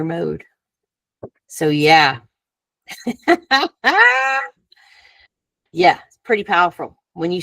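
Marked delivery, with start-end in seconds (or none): 2.9-4.5 high, loud burst of laughter. Real, not acted. Speaker to group after teaching, high laughing.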